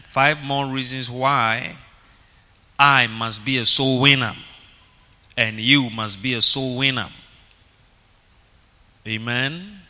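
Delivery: normal talking voice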